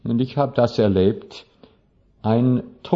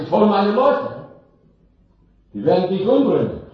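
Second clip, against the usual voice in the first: threatening emperor; 9 semitones higher, faster than usual. Acted. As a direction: threaten Death in a rising panic. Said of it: "Er sagt". (hoher, lauter, aufgeregter Kaiser, der dem Tod droht)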